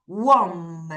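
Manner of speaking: "warm" opened with a 워 glide; W sound drill. Shown as a demonstration. Teacher building the word from its W, slow "warm" starting 워. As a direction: draw out "warm" from a rounded w. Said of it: "W는 무슨 발음이에요?".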